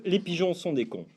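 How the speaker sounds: quiet, matter-of-fact. Acted. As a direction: drop it softly after the shouting. Calm restatement after shout.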